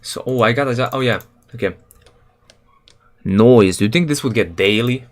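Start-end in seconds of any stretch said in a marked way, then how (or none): none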